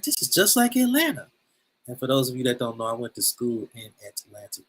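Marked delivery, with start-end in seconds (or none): 0.0-1.3 high-pitched amazed recognition; retold surprise. Telling listeners about Ghana's red clay, in retold surprise. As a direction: relive the sudden recognition, voice jumping up.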